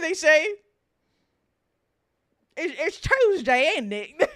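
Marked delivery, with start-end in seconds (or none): 2.6-4.3 mock British. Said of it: "What, what do they say?".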